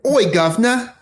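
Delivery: high, loud cockney greeting in a badly faked British accent. Shown as a demonstration. Guest shows the host their poor attempt, bad British accent. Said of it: "It's really bad".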